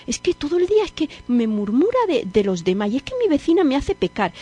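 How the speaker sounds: high, fast whiny excuses; mock a whining penitent making excuses; penitente quejumbroso. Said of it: "en la confesión ... decimos". (penitente quejándose, agudo y rápido)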